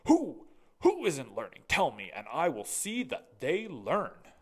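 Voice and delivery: loud, alarmed cry; a headmaster startled into worry; worried headmaster. Acted. Looked very worried.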